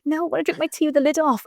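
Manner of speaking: put-on a playfully childish refusal; stubborn insistence. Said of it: "I was like".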